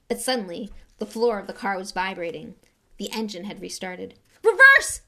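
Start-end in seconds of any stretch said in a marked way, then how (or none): 4.4-5.0 high, loud command yell. Acted. Harry yelling to Ron to reverse. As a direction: shout the order. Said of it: "Harry yelled".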